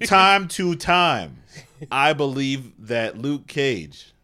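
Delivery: loud, high, drawn-out pundit yell; slow, punched-out hot-take bellow; mock sports commentator yelling; Stephen A. Smith. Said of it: "'cause you yell".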